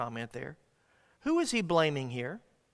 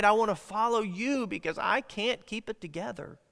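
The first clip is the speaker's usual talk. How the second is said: pleading prayer; 6 semitones higher, 4 dB louder than usual. Acted. Acted prayer of a sinner.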